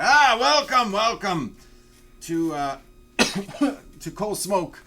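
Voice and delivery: high, loud, gushing host voice; eager Umek welcome. Acted. GM voices Umek greeting adventurers, high and gushing. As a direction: throw your arms open, welcome them in.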